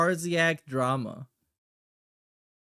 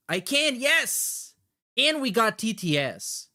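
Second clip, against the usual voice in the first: excited cheer; 7 semitones higher, 4 dB louder than usual. Real, not acted. File opens, celebrates.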